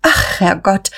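mildly startled cry; sudden exclamation. A narrator's reading. Guste notices the late hour, suddenly exclaiming. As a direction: burst out as if just remembering. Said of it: "rief Guste plötzlich".

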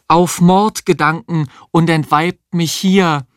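high theatrical declamation; declaiming Shakespeare. A narrator's reading. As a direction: declaim it like a stage actress in the bathtub. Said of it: "aus Macbeth deklamieren". (pathetisch deklamiert wie auf der Bühne)

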